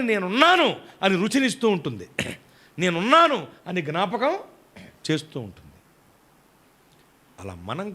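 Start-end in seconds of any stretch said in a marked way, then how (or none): 0.0-0.8 loud, high proclamation of presence; emphatic declaration. Acted. Salt speaking up from inside the curry, loud and emphatic. 2.8-3.5 loud, raised-pitch announcement; emphatic declaration. Acted. Salt reminding the eater it is there, loud and emphatic.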